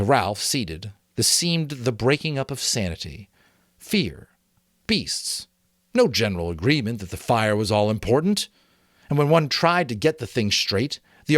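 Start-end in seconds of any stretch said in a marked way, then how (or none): none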